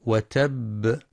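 وتب with the final ب released in a small echo; qalqalah on the ba. Shown as a demonstration. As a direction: bounce the closing ب of وتب. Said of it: "الباء في كلمة".

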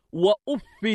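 وأف ending in "in", "uffin"; genitive tanwin. Shown as a demonstration. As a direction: say وأف as "wa-uf-fin". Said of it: "بالخفض".

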